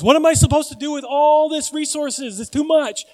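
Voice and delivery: high, loud mock exasperation; rich fool's dilemma. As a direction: act out the rich man overwhelmed by his surplus. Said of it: "After he goes".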